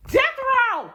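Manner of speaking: theatrical judge's shout; explosive mock verdict. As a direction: yell the sentence as a harsh ruling.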